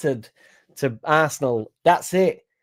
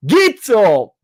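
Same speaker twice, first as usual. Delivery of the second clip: cheer; 9 semitones higher, 8 dB louder than usual. Acted. Match-day cheer.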